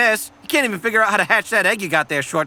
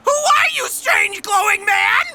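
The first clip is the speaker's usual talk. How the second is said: startled shout; 12 semitones higher than usual. Acted. Adventurer spotting a glowing old man, startled shout. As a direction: yelp it out in shock.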